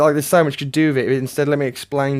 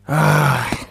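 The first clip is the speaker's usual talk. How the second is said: loud, higher-pitched exasperated sigh; 4 semitones higher, 4 dB louder than usual. Real, not acted. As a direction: heave a big fed-up sigh. Exasperated at the movie.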